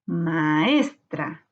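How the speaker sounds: "Maestra" slowly, with ES lifted and lengthened; stressed syllable stretched. Shown as a demonstration. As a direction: lean on the ES of "Maestra". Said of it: "Es en la sílaba tónica".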